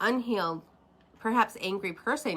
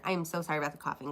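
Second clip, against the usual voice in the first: normal talking voice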